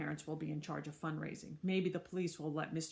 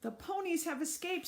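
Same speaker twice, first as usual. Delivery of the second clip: shouting; 9 semitones higher than usual. Acted. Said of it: "someone shouted".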